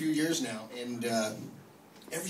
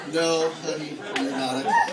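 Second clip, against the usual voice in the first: laughing correction; 6 dB louder than usual. Retold or imitated. Correcting his little daughter, laughing.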